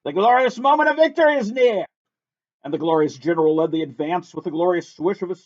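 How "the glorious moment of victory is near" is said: much higher, rousing battle command; rally the troops with a high charge order; yelled battle cry. A narrator's reading. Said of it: "he yelled".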